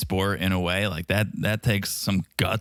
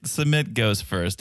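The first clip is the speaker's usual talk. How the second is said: usual speaking voice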